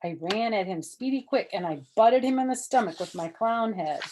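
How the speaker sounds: ordinary delivery, nothing marked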